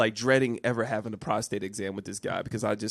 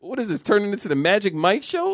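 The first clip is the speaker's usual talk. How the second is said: nervous blurt; 6 semitones higher, 5 dB louder than usual. Retold or imitated. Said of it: "I'm like".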